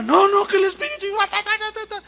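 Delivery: high, loud frantic protest then babble; frantic woman. Retold or imitated. Woman in trance protests and babbles, high and loud. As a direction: ham up the entranced woman. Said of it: "Entonces la hermana".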